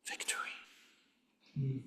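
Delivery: slow breathy whisper, barely voiced. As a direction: breathe the word out like a secret. Dramatic hush on key word.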